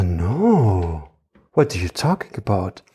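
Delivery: ordinary talk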